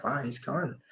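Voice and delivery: soft private thought spoken aloud; said to himself. Retold or imitated. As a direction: say it under your breath, just to yourself. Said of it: "I said that internally to myself".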